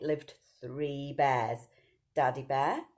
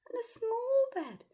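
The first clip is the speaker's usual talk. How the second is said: much higher small voice; tiny voice. A narrator's reading. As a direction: squeak the word small. Small bed for Baby Bear.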